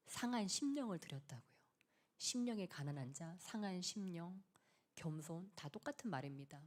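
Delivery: hushed whispered emphasis. Acted. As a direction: lean in and whisper it to the congregation. Preacher drops to a whisper. (조용히 속삭이며)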